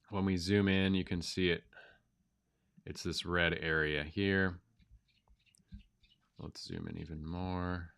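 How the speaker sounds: stuffy nose from a cold; talking with a blocked nose; nasal voice. Real, not acted. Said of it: "goofy voice, nasally voice".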